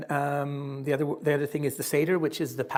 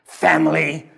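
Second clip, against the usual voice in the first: loud, grinning pirate-like sailor; gruff sailor; 9 dB louder, slower than usual. Acted. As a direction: bellow it like a grinning old sailor. Sailor speaks in story.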